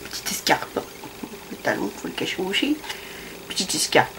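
breathy unvoiced whisper. Real, not acted. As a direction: whisper it like a secret. Hushed aside about the shoe.

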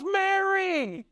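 very high, drawn-out name call; acted high-pitched call; Jesus calling Mary. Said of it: "You can almost hear Jesus".

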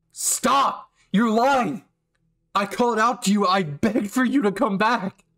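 hurt shout. Acted. Kirishima accusing Bakugo of leaving him, shouting. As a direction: cut him off with a raw cry.

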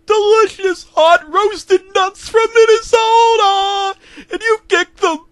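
high, slow, very loud wail; screaming. Acted. Mailman keeps screaming at the man who kicked him, very loud. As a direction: scream it like a wounded vendor. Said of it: "he screamed".

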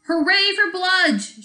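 high, loud, slow cheer; hammed-up crowd cheer; group cheer. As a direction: cheer the captain. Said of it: "shouted the Nins".

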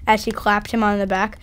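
ordinary delivery, nothing marked

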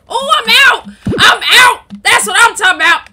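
loud, high burst of triumph; triumphant yell. Real, not acted. Gamer finally gets out after a struggle, triumphant yell. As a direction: holler it out in celebration.

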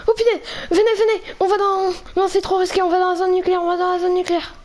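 high, urgent panicked calling. Acted. Player fleeing the Enderman, calling his companion in high panic. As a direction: call him along in frantic alarm.

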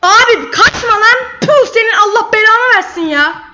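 loud, high-pitched angry outburst; real exasperation. Real, not acted. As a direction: yell at a fleeing opponent. Gegner läuft im Spiel davon.

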